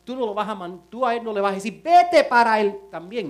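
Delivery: loud, driving sermon build; fiery preaching. Acted. Pastor challenging husbands in church, loud fiery preaching. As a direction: preach it hard to the crowd.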